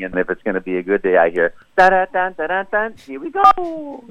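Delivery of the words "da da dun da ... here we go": humming the tune, then a high Mario "here we go"; Mario wake-up jingle. Retold or imitated. Waking up to the Mario theme, sung then high Mario voice. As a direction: sing it, then pop into Mario. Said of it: "I wake up in the morning".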